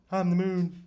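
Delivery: high, loud squeaky voice for the cat; a pet owner speaking for the cat; cat's voice. Pretend. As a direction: answer for the cat in a tiny put-on voice. Voicing the cat's answer.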